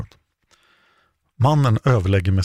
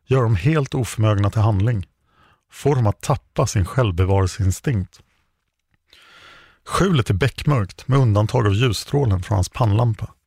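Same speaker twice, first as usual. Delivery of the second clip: ordinary delivery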